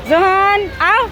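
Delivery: eagerly calling the little one over, coaxing; mother calling Johan to come quickly, high and eager. Real, not acted.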